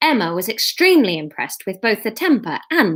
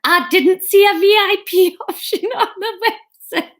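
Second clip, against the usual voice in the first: high Geordie breaking into laughter; Sarah Millican; 10 semitones higher, 4 dB louder than usual. Acted. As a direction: pitch it high in Geordie, barely holding back laughs. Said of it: "Norman's just gonna have to be Sarah Millican".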